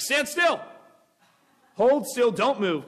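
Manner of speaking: high-pitched, acted cry of alarm; urgent mock warning. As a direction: freeze someone in pretend panic. Imitates bystander warning about bee.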